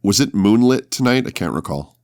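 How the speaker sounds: ordinary talk, nothing marked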